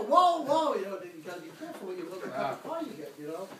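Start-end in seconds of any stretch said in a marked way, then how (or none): 0.0-0.7 high, loud startled whoa. Retold or imitated. Driver recoiling from the cursing GPS, loud startled whoa. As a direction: back off in alarm. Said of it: "I go".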